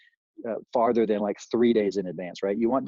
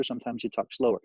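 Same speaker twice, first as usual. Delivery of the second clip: ordinary talk, nothing marked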